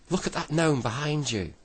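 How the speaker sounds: high, loud warning call; loud shout. A narrator's reading. Joe warning the brownies of the gnome, shouting. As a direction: call out urgently across the clearing. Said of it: "He shouted loudly".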